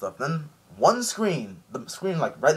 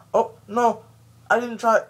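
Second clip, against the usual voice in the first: high, sheepish mock excuse; sheepish denial; 11 semitones higher, 5 dB louder than usual. Mister Three to the Marines, high sheepish denial. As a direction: wriggle out of it, voice up high.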